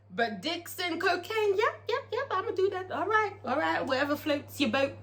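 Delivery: high, soft little mimicking voice; mock willingness. Mocking Roulette's choice of cocaine over liquor, falsely agreeable. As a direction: agree softly and high, sending her up.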